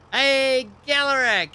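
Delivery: high, loud drawn-out hail; loud greeting. Real, not acted. Streamer hailing a viewer who joins, loud greeting. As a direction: call out a big hello.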